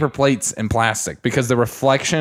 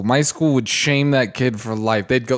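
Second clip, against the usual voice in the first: normal talking voice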